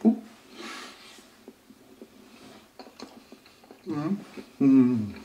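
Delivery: chewing while humming; muffled closed-mouth sounds; mouth full of burger. Real, not acted. Seen 2: Bites burger at -2s, then chews with closed, full mouth throughout.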